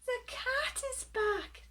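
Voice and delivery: very high, loud trilling giant voices; trilled. Acted. Giants greeting the returning cat, high trill. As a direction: sing it out high like a delighted chorus. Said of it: "they trilled".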